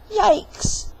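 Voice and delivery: high, loud yikes of alarm; startled yelp. Acted. Jack told to climb the beanstalk, yelping. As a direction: yelp it in sudden fright.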